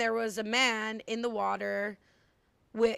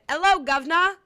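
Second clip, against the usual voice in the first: hammed-up London accent, louder and higher; 6 semitones higher, 8 dB louder than usual. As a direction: belt out a cheeky cartoon London greeting. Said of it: "London accent".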